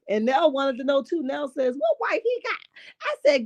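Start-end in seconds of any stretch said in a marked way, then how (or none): 1.8-2.6 high, incredulous question. Retold or imitated. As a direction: ask it in high disbelief. Nell's quote, pitch jumps high.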